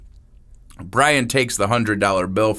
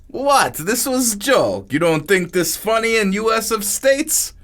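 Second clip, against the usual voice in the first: innocent foreign dealer; 11 semitones higher than usual. Acted. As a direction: play dumb about the joke. Niku shrugging off the prank.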